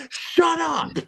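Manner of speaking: squeaky high shout; high-pitched shout. Retold or imitated. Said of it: "She's like".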